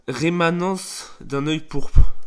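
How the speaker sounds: loud, very slow, theatrical title announcement; declamatory poem title. Acted. Yuri announcing her poem to the club, loud and declamatory. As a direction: declaim it grandly, stretching each word. Annonce le titre du poème.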